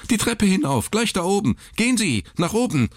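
very high, panicked directions; frightened nurse pointing the way; hysterical. Acted. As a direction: shoo him upstairs in a panic. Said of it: "rief sie hysterisch".

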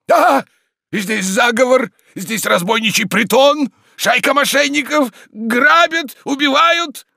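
very high, loud cries of outrage; frantic yelling. Acted. Tarantyev cries robbery and murder, frantic yelling. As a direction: scream for all to hear.

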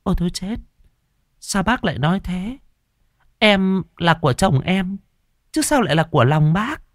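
high, flustered protest; shocked, brushing off his advance; startled woman. Acted. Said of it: "giật mình". (giật mình phản đối)

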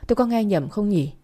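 ordinary delivery

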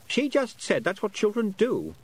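put-on high, lightly boyish voice, puzzled; a lost boy working out how long he's been away; young boy Roland, unsure. A narrator's reading. Lost boy telling Tiffany about time, high and puzzled.

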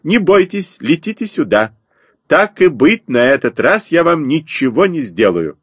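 high, coaxing mama bird; chirping sparrow. A narrator's reading. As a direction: chirp reassurance from the branch, starting high. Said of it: "продолжала щебетать воробьиха". (щебечет высоко, подзывая)

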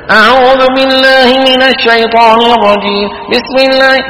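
imitation of over-nasal reciters; nasal recitation. Shown as a demonstration. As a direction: recite with heavy nasal twang. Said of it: "كله الله وكيلك شغال".

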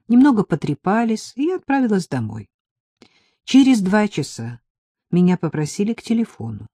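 plain reading voice

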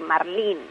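"marlín" stressed on its final LÍN. Shown as a demonstration. Expert explaining where the accent falls, end-stressed "marlín". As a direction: let the I of "marlín" take the accent. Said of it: "se acentúa en la".

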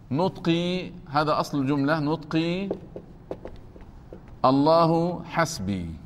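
ordinary delivery

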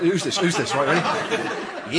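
ordinary delivery

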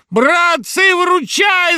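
громкий высокий вопль о помощи; заори в панике; заорал. Acted. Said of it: "заорал Гундосый". (отчаянный вопль)

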